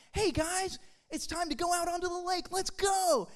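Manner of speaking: cheerful mock shout to the crowd; calling out. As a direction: holler everyone out to the water. Calling the group to the lake.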